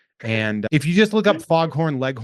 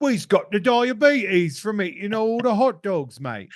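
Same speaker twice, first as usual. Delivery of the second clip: high, slow, loud put-on London accent; hammed-up British bloke grumbling; mock British accent; 9 semitones higher, 3 dB louder, slower than usual. Mocking Chicago hot dogs in a fake London accent. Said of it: "That's my Chicago accent".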